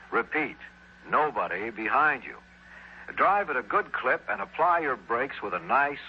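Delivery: usual speaking voice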